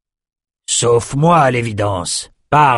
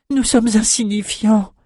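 high, desperate plea; insistent pleading; 10 semitones higher than usual. A narrator's reading. Mutilated shepherd woman pleads with the twins, desperate. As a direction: beg to be spared, voice up high. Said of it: "insista Irma".

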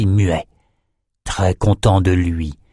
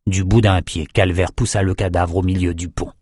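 plain reading voice

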